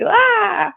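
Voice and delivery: short high mock scream of a tantrum; fit scream. Shown as a demonstration. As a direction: toss out a quick tantrum yelp. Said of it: "a fit scream is more just like".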